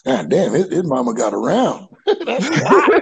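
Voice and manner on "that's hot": high laugh through the words. Real, not acted. Laughing at the host's mama joke, high and laughing. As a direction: crack up while saying it.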